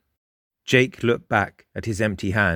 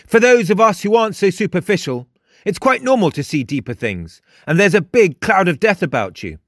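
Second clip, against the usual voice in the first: higher, emphatic character voice; cocky, probing, pitched up; self-assured Frost; 7 semitones higher, 4 dB louder than usual. A narrator's reading. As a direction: press the listener with knowing insistence. Frost presses Jake.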